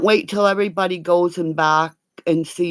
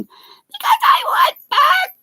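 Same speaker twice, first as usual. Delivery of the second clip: sudden shout; 5 dB louder than usual. Real, not acted. Agitated rant.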